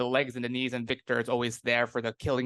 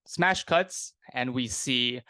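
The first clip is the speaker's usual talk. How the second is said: ordinary talk, nothing marked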